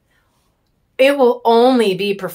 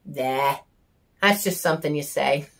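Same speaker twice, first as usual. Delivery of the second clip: dismissive husband; 6 semitones lower, 6 dB quieter than usual. Retold or imitated. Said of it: "is that I want a divorce".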